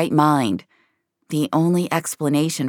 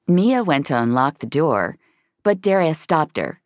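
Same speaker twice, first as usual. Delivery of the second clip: ordinary delivery, nothing marked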